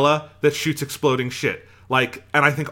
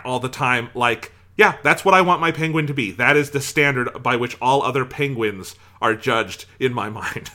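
usual speaking voice

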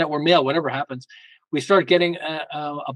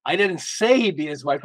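"say" stressed, as if it was only implied. Shown as a demonstration. Showing how one sentence changes meaning, stress on SAY. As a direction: lean hard on the word "say". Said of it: "all the different ways it could be interpreted".

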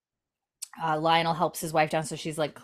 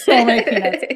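laughing loudly; 6 semitones higher, 8 dB louder than usual. Real, not acted. Laughing at Lizzie shucking.

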